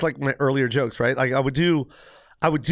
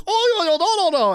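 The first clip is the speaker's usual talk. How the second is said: very high-pitched cartoon babble; exaggerated high cartoon yelping; cartoon caricature; 3 dB louder than usual. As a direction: imitate an old cartoon character in a squeaky high voice. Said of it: "you're watching a cartoon".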